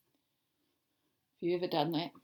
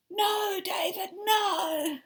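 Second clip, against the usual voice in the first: loud high slow shout of no; a mother stopping her child, yelling; stern shout; 10 semitones higher, 6 dB louder, much slower than usual. Acted. Mother reading the book, shouting at David.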